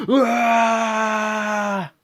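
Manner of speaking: hammed-up high shouted roar; mock roar. Fan acting out Strowman's roar before the break, hammed-up roar. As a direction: let out a big cartoon war cry. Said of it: "he does this whole".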